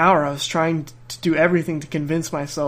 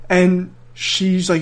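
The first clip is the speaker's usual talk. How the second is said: usual speaking voice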